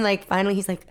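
normal talking voice

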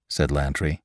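ordinary delivery, nothing marked

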